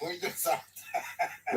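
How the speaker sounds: breathy laugh through the words; giggling through the words. Real, not acted. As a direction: laugh it off as you say it.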